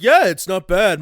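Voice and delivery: hammed-up dad reassurance; imitated dad. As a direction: play the dad looking round, loudly. Acting out dad in story.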